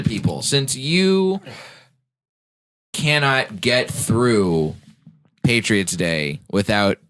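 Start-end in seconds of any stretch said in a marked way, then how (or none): 2.9-7.1 slow emphatic. Real, not acted.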